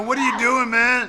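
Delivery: high, loud exasperated cry; loud character outburst. Retold or imitated. Character to Mia eating from a tube, loud exasperation. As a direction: demand it loudly of your friend. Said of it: "I'm just like".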